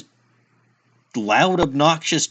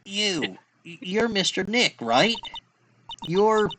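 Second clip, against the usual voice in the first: Maya's voice; 5 semitones higher, 7 dB quieter than usual. Retold or imitated. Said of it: "what she said to me".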